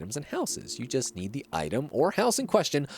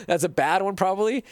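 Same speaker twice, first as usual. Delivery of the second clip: normal talking voice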